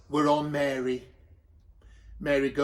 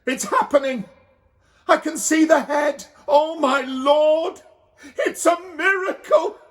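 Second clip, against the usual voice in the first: much higher, loud tearful joy; overwhelmed crying; 10 semitones higher, 6 dB louder than usual. A narrator's reading. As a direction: sob out the miracle. Mary giving birth, overwhelmed.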